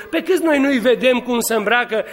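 ordinary talk, nothing marked

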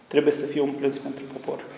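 ordinary talk

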